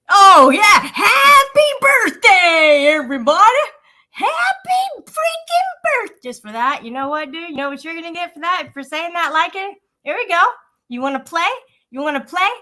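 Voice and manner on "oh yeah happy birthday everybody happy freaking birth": mock celebration. Mock birthday celebration, breaks off.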